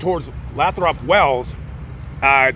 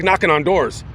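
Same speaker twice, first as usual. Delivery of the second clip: plain reading voice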